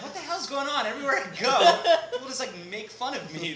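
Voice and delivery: high, loud mock-exasperated Scottish accent, laughing; exasperated Tony. Tony complaining that people mock his accent, laughing. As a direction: whine it in Tony's Scottish accent. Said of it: "he's going like".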